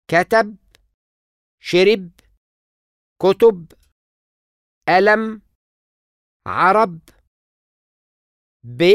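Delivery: example words said apart, first syllable stressed; penultimate stress demo. Shown as a demonstration. Teacher demonstrating stress in two-syllable words. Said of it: "كلمة من مقطعين".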